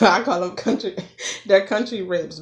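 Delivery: laughing through the words. Real, not acted. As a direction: giggle while you say it. Laughing while naming ribs.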